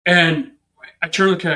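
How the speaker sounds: normal talking voice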